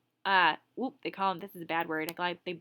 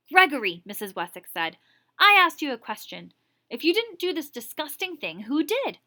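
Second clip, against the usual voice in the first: high, loud, demanding; angry scolding; 5 semitones higher, 7 dB louder than usual. Acted. Teacher demanding the boy name the culprit, high loud scolding. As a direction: scold him into naming the culprit.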